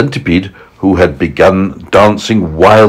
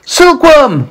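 high, urgent call; yelling; 3 dB louder than usual. A narrator's reading. James calling the silkworm to the rescue, yelling. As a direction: shout for help from a friend. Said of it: "yelled James".

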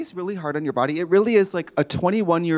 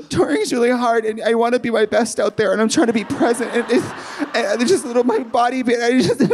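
higher, laughing tearful ramble; teary rambling; 6 semitones higher than usual. Retold or imitated. Explaining to the masseuse, tearful and laughing. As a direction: ramble like about to cry. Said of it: "I was like".

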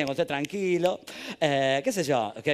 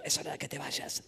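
hushed, voiceless whisper; conspiratorial whisper; 3 semitones lower, 11 dB quieter than usual. Acted. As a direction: whisper a secret urging to escape. Gnome secretly urges escape.

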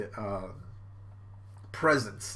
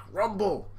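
ordinary talk

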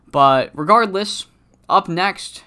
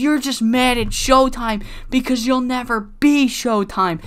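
much higher, sneering comeback; high mocking taunt; 9 semitones higher than usual. Rival fires back in promo.

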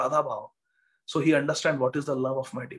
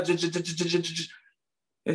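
rapid stuttered j-j-j of teeth; chattering teeth; 4 dB quieter, faster than usual. Retold or imitated. Teacher shows devotees the Lord's trembling ecstasy, chattering teeth. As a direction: rattle the syllable like chattering teeth.

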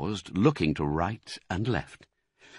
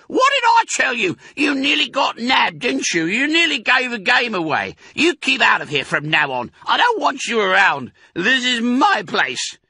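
very high, loud rat screech; shrieking Rat; 6 dB louder than usual. Acted. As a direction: scream at them from the shelf.

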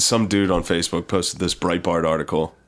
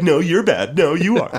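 mock squabble; 8 semitones higher, slower than usual. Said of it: "they're like".

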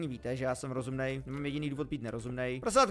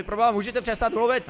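loud, raised scolding; annoyed call-out; 7 semitones higher, 9 dB louder than usual. Real, not acted. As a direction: shout the animals down. Scolding noisy farm animals.

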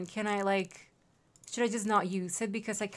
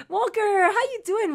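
high and louder, laughing through the name; giggly high-voiced hello; laughing high greeting; 11 semitones higher, 6 dB louder than usual. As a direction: greet him with a bubbling laugh, pitched way up. Greeting a character named Walker.

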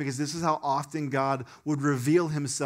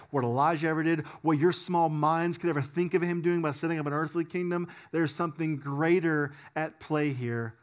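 usual speaking voice

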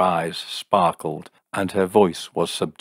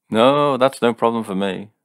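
louder, higher, forced breeziness; studied levity; 5 semitones higher, 6 dB louder than usual. A narrator's reading. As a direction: brush it off too breezily. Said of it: "said Jim with studied levity".

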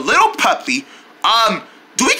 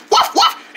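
barking like a dog. Pretend. High bark in the song.

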